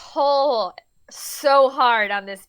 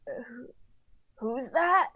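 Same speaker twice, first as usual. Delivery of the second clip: groggy; 8 dB quieter, slower than usual. Acted. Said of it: "groggily looks up and is like".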